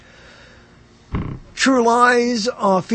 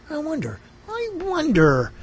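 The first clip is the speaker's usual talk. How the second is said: mock wondering; 11 semitones higher than usual. Musing listener imitated.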